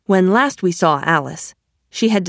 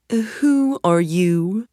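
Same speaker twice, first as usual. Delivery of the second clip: drawn-out, lofty caterpillar; slow caterpillar voice; much slower than usual. A narrator's reading. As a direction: ask it slowly and loftily.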